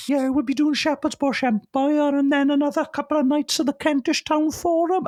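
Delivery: throat squeezed shut with nerves, voice jumped way up; nerves-strangled voice. Retold or imitated. Nervous fan talks to his hero, voice choked high. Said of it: "not only has my voice gone high".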